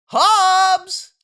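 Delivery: high, loud call into the woods. Acted. Father searching the night woods, loud high call. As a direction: shout the name into the dark. Dad calling in dark woods.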